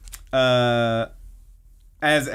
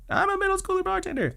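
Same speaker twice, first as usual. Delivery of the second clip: very high, quick squeaky recital; forced repeat; 4 dB quieter, faster than usual. Retold or imitated. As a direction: squeak it out fast, as if made to repeat it. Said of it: "like, louder".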